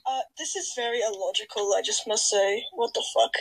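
low, soft mock-serious voice; deadpan objection. Calling the skit illogical, quiet deadpan. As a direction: object quietly, pitched well down.

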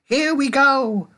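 high, loud, stretched whoop; joyous high-pitched holler; gleeful shout. A narrator's reading. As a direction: cheer wildly as the ride takes off. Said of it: "shouted Grandpa Joe again".

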